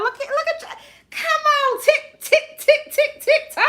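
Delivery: very high-pitched cheer with ticking chant; excited squealing. Real, not acted. Host egging on screen-tapping viewers, high excited squeal.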